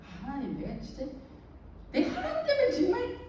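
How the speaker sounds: retold exasperated prayer, lightly done; annoyed grumble to God. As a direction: vent to God in half-comic frustration. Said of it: "너무 화가 확 나더라고요".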